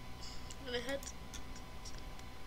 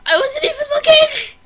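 high, loud protest; indignant high yell; shocked outcry; 14 semitones higher, 12 dB louder than usual. Real, not acted. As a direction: cry out in alarm, voice shooting up. Nearly killed while not looking.

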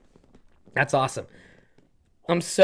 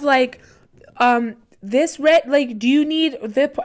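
put-on high, slow, dithering girl's voice; rambling student; 11 semitones higher, 4 dB louder, slower than usual. Student asks the teacher a muddled question, high and dithering. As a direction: drag it out like a lost teenager. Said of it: "one of those questions in a high school class".

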